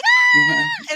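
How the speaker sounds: very high, loud shriek of fright; high scream. Retold or imitated. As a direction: scream at the top of your voice. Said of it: "we started screaming".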